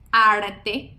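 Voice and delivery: "arte" with a single tap before the T; tap R before consonant. Shown as a demonstration. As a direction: say "arte" with one quick tap. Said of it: "posición inversa cuando decimos".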